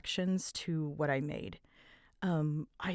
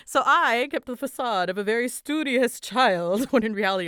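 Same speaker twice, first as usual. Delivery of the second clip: giggling through the confession, louder; laughing; 6 semitones higher, 10 dB louder than usual. Real, not acted. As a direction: laugh while owning up to the trick. Confessing childhood trick, laughing.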